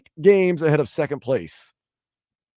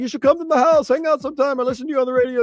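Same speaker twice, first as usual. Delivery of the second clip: high-pitched, hammed-up British accent impression; terrible British accent; 10 semitones higher, 3 dB louder, faster than usual. Host mimicking a British radio guy's invitation in a bad British accent. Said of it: "that's a terrible British accent".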